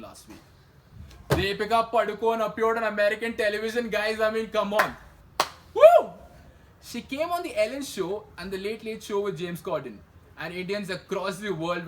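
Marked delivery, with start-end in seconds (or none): 5.7-6.1 high, very loud cheer; excited whoop. Real, not acted. Host cheering Deepika on American television, loud whoop.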